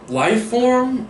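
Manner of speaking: life form jumps up high; "life form" said high and amused; stress on form. Real, not acted. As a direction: lift "life form" way up, half joking. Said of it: "I don't wanna say lower life form".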